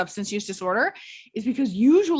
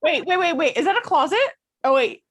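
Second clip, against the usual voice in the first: higher and louder, playful alarm; excited teasing; 10 semitones higher, 6 dB louder than usual. Real, not acted. Host teasing a guest about hiding his son, excited. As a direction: jump in, amused and surprised, calling out.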